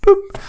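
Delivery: short, very high, loud "boop"; imitated boop. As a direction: pop out a squeaky little sound effect. Imitating a sound from the credits.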